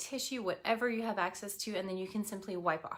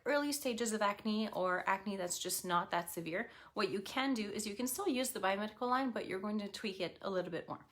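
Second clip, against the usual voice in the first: usual speaking voice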